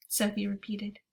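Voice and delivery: ordinary delivery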